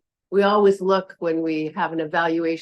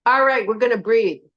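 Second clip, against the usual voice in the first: higher, firm brusque instruction; brusque announcement; 4 semitones higher than usual. Shown as a demonstration. Yoga teacher contrasting tones for the class, brusque instruction. Tart take of breathing instruction.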